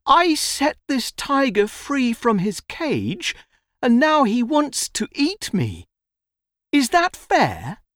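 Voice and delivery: put-on high-pitched, aggrieved child; young boy appealing. A narrator's reading. Boy asks the donkey to judge, appealing. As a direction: plead your case in a high voice.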